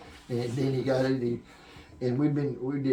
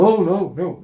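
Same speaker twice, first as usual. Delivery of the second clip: alarmed refusal; 8 semitones higher, 12 dB louder than usual. Retold or imitated. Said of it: "Chris went".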